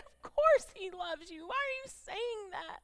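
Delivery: high-pitched, tearful protest; real tearful upset. Real, not acted. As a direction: fight through tears to reassure him. Tearful retelling of son's breakdown.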